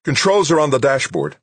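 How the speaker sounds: urgent called-out instruction; calling out. A narrator's reading. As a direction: shout directions across a distance. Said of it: "Doug called to her".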